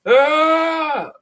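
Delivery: high sharp scream; short hammed-up shriek; yelping scream as he grabs the axe; mock scream. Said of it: "he's like".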